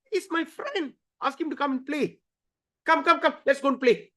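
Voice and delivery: put-on high, soft pleading little boy; a child who cannot see the problem; innocent child. Boy asking the guards to send his friend out, high and pleading.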